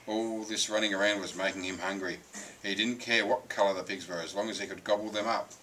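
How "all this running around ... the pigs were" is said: usual speaking voice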